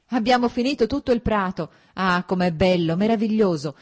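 bursting in, loud and high with delight; joyful outburst. A narrator's reading. Levin bursts in shouting happily to his brother. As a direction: shout it out, thrilled after mowing. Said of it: "irruppe in camera con un allegro vociare".

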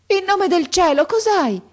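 very high, loud cry of panic; alarmed scream. A narrator's reading. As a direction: shriek in frantic alarm. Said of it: "gridò la vecchia palpando affannosamente". (grido acuto, allarmato)

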